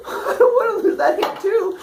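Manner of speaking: high-pitched mock crying; tearful whine. Student facing the hot stove a second time, mock tearful. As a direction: wail it as if tears are streaming down. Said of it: "as the tears are coming down your face".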